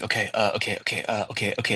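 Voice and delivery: mildly flustered mumbling; dazed agreement. Retold or imitated. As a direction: keep stalling, half to yourself. Retold dazed reply to stage manager.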